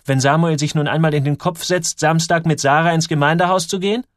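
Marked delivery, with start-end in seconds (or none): none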